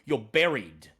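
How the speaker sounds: low, slow, ominous storyteller voice; dramatic narration. A narrator's reading. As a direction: draw the players into the darkness. GM narrates the death scene.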